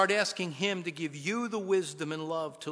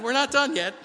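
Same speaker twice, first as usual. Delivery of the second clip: higher, louder teasing line; teasing wife; 8 semitones higher, 10 dB louder than usual. Retold or imitated. Wife teasing husband in line, loud and playful. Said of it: "And then added".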